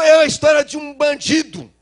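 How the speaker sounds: father's loud outburst; angry shout. Retold or imitated. Father shouting at his son about the thief.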